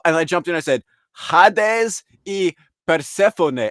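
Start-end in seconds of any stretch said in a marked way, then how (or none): 1.2-3.7 put-on slow, high, emphatic Spanish accent; Spanish-accented names, loud and drawn out. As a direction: say the names slowly with Spanish vowels. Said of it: "I just said it with a Spanish accent".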